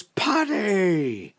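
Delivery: big drawn-out call, high on the name; booming hail across a field; shouting down. Acted. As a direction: holler down to someone outside. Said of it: "the man shouts down".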